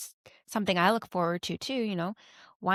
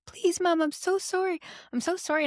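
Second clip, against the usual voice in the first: high-pitched imitation of a bawling child; mock crying plea; 10 semitones higher than usual. Child begging Mom at the door, mock tearful and high. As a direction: plead like a sobbing kid, hammed-up. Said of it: "like, crying and bawling".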